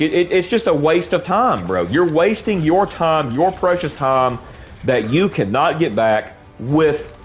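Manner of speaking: usual speaking voice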